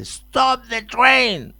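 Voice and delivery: slow drawn-out yell, high and loud; movie shout. Retold or imitated. Quoting a movie director yelling at a departing train, slow loud shout. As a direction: bellow it like the film's desperate director. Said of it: "igual que en la película que dice".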